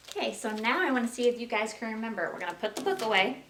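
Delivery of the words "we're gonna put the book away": usual speaking voice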